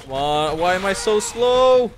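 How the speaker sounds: high, loud desperate yell; scared horror game streamer. Real, not acted. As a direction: yell at the monster in panic. Fleeing monster.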